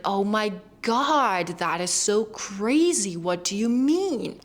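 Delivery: dramatic, drawn-out words with swooping pitch; expressive American exclamation. Shown as a demonstration. Showing the freedom of American English, dramatic and stretched. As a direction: stretch out the key words like an accordion, slowly. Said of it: "showing how you feel by how you pronounce".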